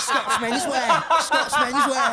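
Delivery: loud, high words through laughter; laughing hard. Real, not acted. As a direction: crack up while getting the words out. Cracking up with friend over coat.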